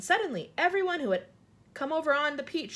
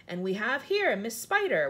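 ordinary delivery

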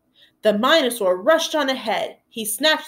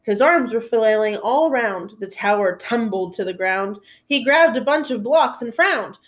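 ordinary delivery, nothing marked

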